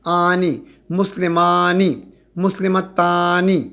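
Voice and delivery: مسلمانی and مسلمتانی drawn out slowly; slow demo. Shown as a demonstration. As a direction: let the آنی sound ring clearly. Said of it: "آنی کی آواز نکل رہی ہے". (آنی کی آواز واضح کرتے ہوئے آہستہ)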